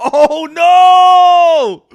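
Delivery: loud, high cry of no; real laughing outcry; laughing shout. Real, not acted. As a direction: yelp it in disbelief. Reacting laughing to chat.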